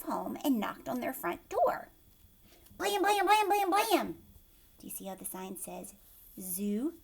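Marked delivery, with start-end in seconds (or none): 2.8-4.2 high punchy knocks; knocking blam. A narrator's reading. Parents knocking on the front door, punchy blams. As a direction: pound out each blam.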